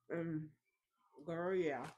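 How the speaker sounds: talking while chewing ice cream bar; mouth full. Real, not acted. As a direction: mouth full, eating as she talks. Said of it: "I'm being a, such a slob right now".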